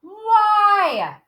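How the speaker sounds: high called-out M and Y; screamed letters. Shown as a demonstration. Teacher has kids scream the power word, high called letters. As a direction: call each letter out high. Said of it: "scream it out".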